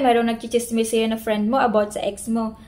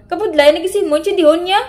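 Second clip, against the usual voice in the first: higher and louder, pushed up in anger; angry rising Ilonggo complaint; 5 semitones higher, 5 dB louder, much faster than usual. Shown as a demonstration. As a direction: let the pitch climb like a lovers' quarrel. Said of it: "pataas talaga yung tone".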